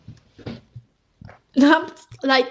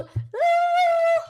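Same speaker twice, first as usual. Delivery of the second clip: high squeal. Real, not acted. Shocked at savings statistic.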